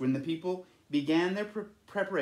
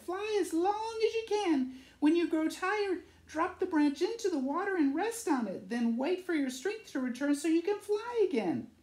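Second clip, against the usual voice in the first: high, light swallow voice; Lolita's voice. Acted. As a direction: pitch it up like a small bird. Lolita instructing Nacho.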